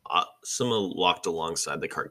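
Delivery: ordinary delivery, nothing marked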